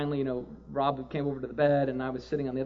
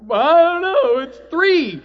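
high, loud, bursting answer; excited reply; 14 semitones higher, 14 dB louder, much slower than usual. Retold or imitated. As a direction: blurt it out with joy. They answer her excitedly.

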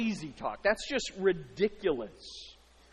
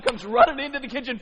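usual speaking voice

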